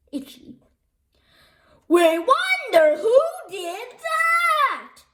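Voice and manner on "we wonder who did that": slow loud chanted shout; crowd shout. A narrator's reading. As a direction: bellow it like a whole crowd. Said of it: "shouted the fans".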